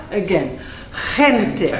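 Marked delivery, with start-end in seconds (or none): 1.1-1.7 "Gente" with a throaty German-style H for the G; guttural G. Shown as a demonstration. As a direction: say "Gente" starting with a raspy kh sound. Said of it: "call on the Germans again to help us".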